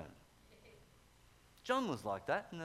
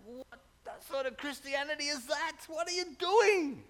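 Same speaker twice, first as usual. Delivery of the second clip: rising, disbelieving challenge, much higher and louder at the end; incredulous retort; 11 semitones higher, 6 dB louder than usual. Retold or imitated. Preacher mocking a lukewarm believer, incredulous and rising. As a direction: scoff at the idea in disbelief. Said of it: "I'm like".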